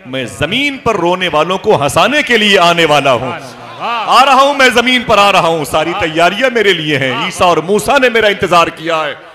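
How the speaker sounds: very loud, high proclamation; full-force sermon voice; impassioned preaching. Retold or imitated. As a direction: thunder it out as a heavenly promise.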